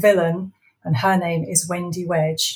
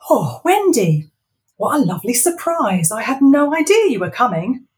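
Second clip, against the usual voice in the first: high, loud fake delight; nervous Ivor; 5 semitones higher, 5 dB louder than usual. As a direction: cover your fear with false cheer. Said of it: "Ivor said".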